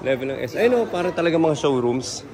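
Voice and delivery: usual speaking voice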